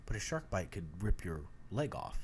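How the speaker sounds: low, hushed comeback. Retold or imitated. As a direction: mutter it low and deadpan. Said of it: "I'm like".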